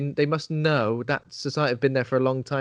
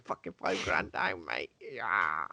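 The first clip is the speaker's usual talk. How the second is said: grumbling; 10 semitones lower, 9 dB quieter, slower than usual. Retold or imitated. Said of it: "every, every, like, ten seconds".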